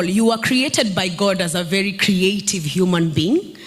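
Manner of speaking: normal talking voice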